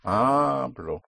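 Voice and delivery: "Hablo" with a long HA and short falling -blo; push on first syllable. Shown as a demonstration. Teacher models "I speak", long first-syllable stress. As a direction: stretch and press the start of "Hablo". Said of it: "push down on the first syllable".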